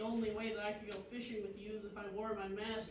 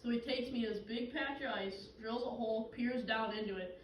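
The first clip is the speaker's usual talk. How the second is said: ordinary talk, nothing marked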